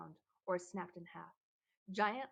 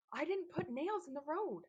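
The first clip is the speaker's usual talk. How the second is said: alarmed denial; 8 semitones higher, 4 dB louder than usual. A narrator's reading. Antbite protests his innocence.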